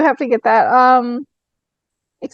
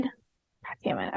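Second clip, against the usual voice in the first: muttered curse; 14 semitones lower, 17 dB quieter, slower than usual. Real, not acted. Staff costs more than hoped.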